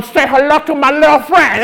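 lightly voiced tough action hero; action hero line. Taunting the little weeds in a mild action-hero voice. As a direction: growl it like a movie badass. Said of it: "feeling like the Terminator".

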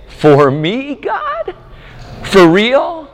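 high, astonished questioning; childlike wonder. Pretend. Preacher urging believers to receive grace, high childlike astonishment. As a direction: ask in disbelief like a small child. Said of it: "be just like my three-year-old and say".

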